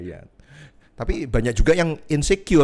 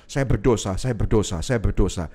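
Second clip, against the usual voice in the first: guilt-ridden repetition; 7 semitones lower than usual. Retold or imitated. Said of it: "itu terus menerus".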